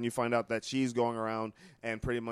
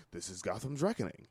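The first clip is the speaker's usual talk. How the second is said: low, soft menacing line; badass delivery; 3 semitones lower, 6 dB quieter than usual. Shown as a demonstration. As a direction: say it like a lower, quieter tough guy. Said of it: "If I was to say that and I was a badass".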